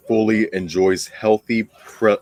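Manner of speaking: ordinary talk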